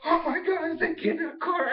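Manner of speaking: sudden alarmed discovery; shocked gasp. Retold or imitated. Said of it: "and like".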